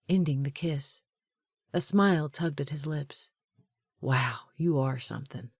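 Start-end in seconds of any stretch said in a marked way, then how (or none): none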